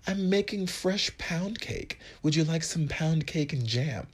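high, slow mock posh British; British accent. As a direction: play the roommate in a British accent. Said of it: "I don't know why I talked like that".

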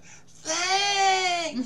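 high, gushing mock thanks; fake grateful thanks. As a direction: squeal a hammed-up thank you. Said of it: "They said".

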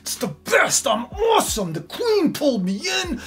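high, shouted triumphant crowing; gloating excitement. Retold or imitated. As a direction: crow like a puffed-up villain. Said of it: "Haman's so super excited".

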